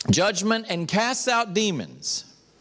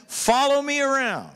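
higher-pitched, ringing call to follow; commanding invitation; 4 semitones higher than usual. Acted. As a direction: call the followers along with authority. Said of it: "he was saying".

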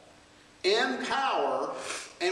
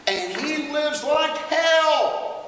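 mock complaint; 6 semitones higher, 7 dB louder, much slower than usual. Acted complaining churchgoer quote.

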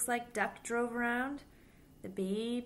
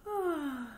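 yawn; 8 semitones higher, 3 dB louder than usual. Acted. Animals yawn in story.

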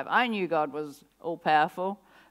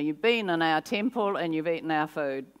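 usual speaking voice

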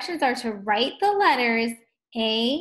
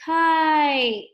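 KITE with the long I held out, louder; stretched vowel; 5 semitones higher, 7 dB louder than usual. Shown as a demonstration. Teacher showing the long I sound, KITE stretched loudly.

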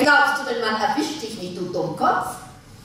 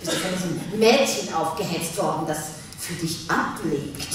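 sarcastic; 7 semitones lower, 4 dB quieter than usual. Acted. Wife mocks husband about his secretary, sarcastically. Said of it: "Werde bloß nicht sarkastisch".

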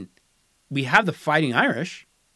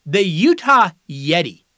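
drawn-out reveal of the name, a bit louder; slow name announcement; 6 dB louder than usual. Acted. Host unveiling a proposed team name, slowly announced. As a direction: announce it slowly like a draft pick. Reading out candidate team names.

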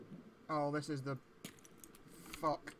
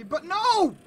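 sudden loud cry of no, pitched way up; shouted no; 15 dB louder than usual. Real, not acted. Gamer yelling at the screen, loud high no. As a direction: blurt it out loud in protest.

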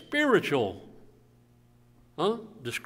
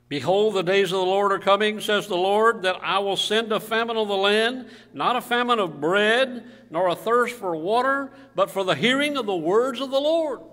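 loud, ringing Bible reading; preacher declaiming scripture, voice lifted; scripture reading aloud; 3 semitones higher, 6 dB louder than usual. Real, not acted. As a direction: proclaim it like a prophet's warning. Reads scripture to congregation.

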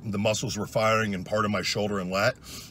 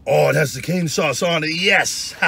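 excited; 7 semitones higher, 8 dB louder, slower than usual. Real, not acted. Excited by the sauce.

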